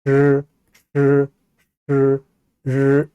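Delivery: 르 with the tongue curled back; retroflex sound. Shown as a demonstration. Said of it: "혀를 말고 하면 권설음이 돼서".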